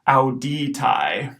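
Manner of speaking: slow auditai, ow-dee-tye; diphthong demo. Shown as a demonstration. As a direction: model auditai syllable by syllable. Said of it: "this whole word is auditai".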